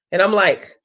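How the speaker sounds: ordinary delivery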